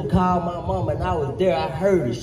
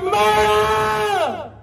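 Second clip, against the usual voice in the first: screaming murder; 11 semitones higher than usual. Retold or imitated. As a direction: hold the scream out for the whole street. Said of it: "She's screaming".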